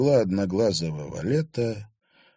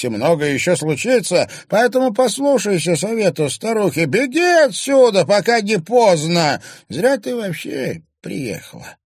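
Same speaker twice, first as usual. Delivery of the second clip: put-on ominous old crone; 11 semitones higher, 5 dB louder than usual. A narrator's reading. Old crone warns the girl to run, high and ominous. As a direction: urge her to flee in a dark crone voice.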